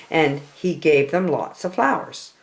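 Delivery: plain reading voice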